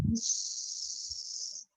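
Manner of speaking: long whispered hiss; drawn-out breathy snake hiss. Shown as a demonstration. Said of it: "do you know the sound of snake".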